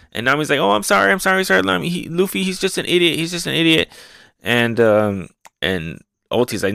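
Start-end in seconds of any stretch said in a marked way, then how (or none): 0.6-3.9 higher, frightened apology; lightly voiced girl apologizing in fear; mock pleading. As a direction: grovel in a high voice. Retelling manga, Nami apologizing.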